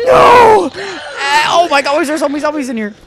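panicked high shriek; frantic, fast, fearful cry; being chased. Real, not acted. Fleeing, runs into zombies.

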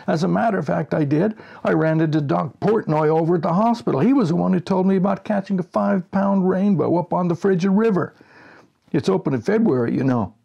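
high, loud, plaintive friend on the phone; Reg's voice. Acted. Reg complaining over the phone that the cure didn't work, high and loud. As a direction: whine that the cure failed.

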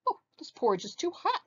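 high-pitched pained wail; a storybook wail, high and whimpering. A narrator's reading. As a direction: wail it out like a child with a burnt tongue. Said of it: "she wailed".

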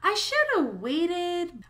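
high, slow, whiny regret; regretful inner voice. Retold or imitated. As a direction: moan it like a regretful thought. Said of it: "and I was like".

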